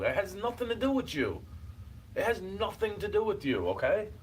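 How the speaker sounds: sounding mean. Real, not acted. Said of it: "when I start to sound like this".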